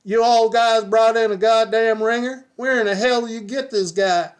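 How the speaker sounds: loud, high southern drawl imitating a police officer. Police officer ribbing the team about a ringer, in a loud high Southern drawl. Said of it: "in that southern drawl".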